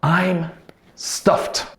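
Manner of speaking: "stuffed" breathed out unvoiced; slow model with whispered end. Shown as a demonstration. Teacher modelling the phrase slowly for learners. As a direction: let "stuffed" fade into a whisper. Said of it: "This phrase was".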